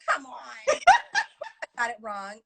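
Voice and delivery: normal talking voice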